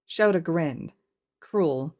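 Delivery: plain reading voice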